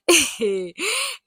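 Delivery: high squealing burst of laughter. Real, not acted. Vlogger laughing at the doctor's joke, high squeal. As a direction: laugh out, delighted.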